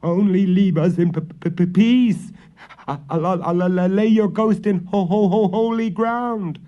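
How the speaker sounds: high, loud panicked stutter; terrified stammer. A narrator's reading. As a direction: plead while tripping on words. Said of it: "he stammered".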